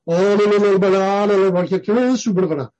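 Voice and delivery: rapid run of babbled syllables; imitated speaking in tongues. Preacher mimics the tongues heard in charismatic churches, rapid babble. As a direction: mimic unintelligible tongues, rattling on without breaks. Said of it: "这个听到的这些啊".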